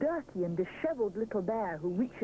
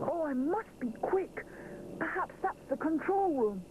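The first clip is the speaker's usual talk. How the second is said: a touch of urgency; 9 semitones higher than usual. A narrator's reading. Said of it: "looks round desperately".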